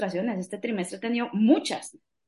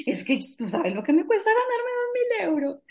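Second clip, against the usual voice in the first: high tearful wail, much higher; retelling her sobbing plea to her husband, pitch way up; imitating her crying; 11 semitones higher than usual. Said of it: "lloraba como Magdalena". (imitando el llanto, voz aguda y quebrada)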